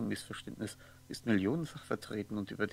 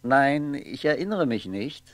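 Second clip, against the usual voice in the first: loud denial; 2 semitones higher, 10 dB louder, slower than usual. Acted. Martin denies remembering, escalating.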